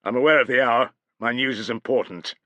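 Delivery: restrained angry snarl. A narrator's reading. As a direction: snarl through anger. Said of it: "he snarled".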